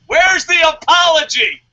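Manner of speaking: loud, high-pitched shouted demand; mock-outraged shout, pitched high; indignant demand. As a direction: call him out at a shout. Demands apology from co-host.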